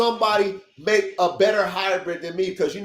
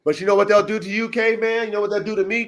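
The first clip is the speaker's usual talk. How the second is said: ordinary talk, nothing marked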